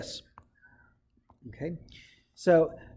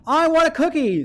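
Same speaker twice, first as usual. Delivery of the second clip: much higher and louder, demanding the cookie; excited angry shout; 11 semitones higher, 7 dB louder than usual. Shown as a demonstration. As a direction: blurt it out, excited and cross. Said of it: "with a lot of excitement and anger".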